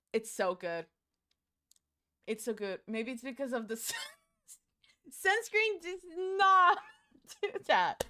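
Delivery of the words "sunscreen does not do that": high, loud and slow, laughing through it; mock the idea while cracking up; laughing mock outrage. Mocking the sunscreen claim to chat, laughing and loud.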